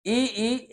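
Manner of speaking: ई sustained as a tongue-position demo; demo vowel. Shown as a demonstration. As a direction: stretch ई out so they feel the tongue forward. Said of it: "तो एक ठो याद रखो ई".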